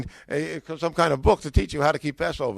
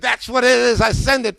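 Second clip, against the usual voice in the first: higher, louder enthusiastic yes; eager ordering; 6 semitones higher, 6 dB louder than usual. Retold or imitated. Customer urging the bookseller to ship, excited. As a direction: seize on it and urge her on.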